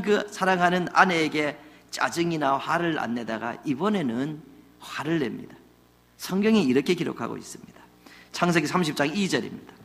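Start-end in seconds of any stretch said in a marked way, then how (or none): none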